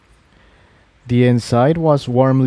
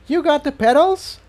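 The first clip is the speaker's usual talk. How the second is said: put-on much higher young mare, eager question; female voice. A narrator's reading. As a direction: call back from behind a door, surprised and hopeful. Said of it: "came a female voice".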